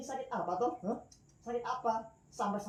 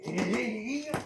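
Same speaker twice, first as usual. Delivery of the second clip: normal talking voice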